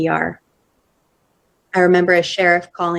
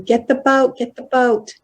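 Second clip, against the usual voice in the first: higher, urgent mock yell of a boat alarm; retold yell; 6 semitones higher than usual. Diver yelling to the crew for the boat, raised urgent call. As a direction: call it out like someone yelling across the water. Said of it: "somebody yelling".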